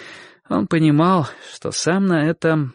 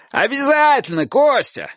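high, eager call; shouting; 13 semitones higher than usual. A narrator's reading. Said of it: "крикнул человек с крыши". (бодрый крик с крыши)

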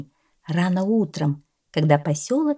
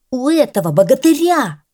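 proud boast; 7 semitones higher, 7 dB louder than usual. Acted. Cat praises the dog.

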